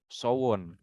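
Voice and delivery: "Sowon" said as so-won, two syllables; correct pronunciation. Shown as a demonstration. Coach corrects how a fan name is read: "Sowon" as so-won. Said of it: "Tapi cara bacanya bukan Sone".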